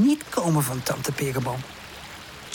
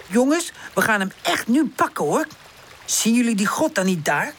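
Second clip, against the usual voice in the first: tag; 7 semitones higher than usual. A narrator's reading. Woezel rallies the others to go.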